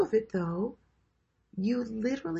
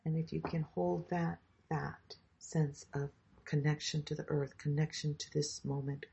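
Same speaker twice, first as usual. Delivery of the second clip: guided meditation; 2 semitones lower than usual. Acted. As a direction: guide them gently into the body. Leads a grounding meditation.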